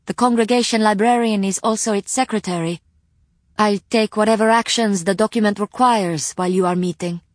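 lightly voiced courteous librarian; polite librarian voice. Rima explains duties.

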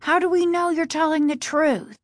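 high, challenging question; insistent. A narrator's reading. Casey doubting his father's story, insistent. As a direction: challenge him, voice held high. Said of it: "Casey insisted".

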